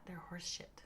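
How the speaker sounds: whispered, breathy aside under the mic. Real, not acted. Streamer whispering a rude complaint about the tools, hushed. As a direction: hush it like a secret swear.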